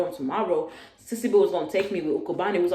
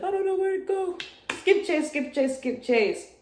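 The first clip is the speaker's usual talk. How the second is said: much higher mocking helpless plea; mock whiny girl; 12 semitones higher than usual. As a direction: whine it like the stranded roommate. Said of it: "she gonna call me like".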